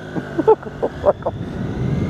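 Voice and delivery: rueful burst of laughter; real laugh. Real, not acted. Rider laughing at his botched U-turn, real laugh. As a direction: laugh off your own clumsy mistake.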